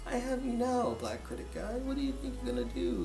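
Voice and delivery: very soft mock-villain menace; Voldemort Junior. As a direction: threaten under your breath, barely audible. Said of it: "Voldemort Junior was talking to me like".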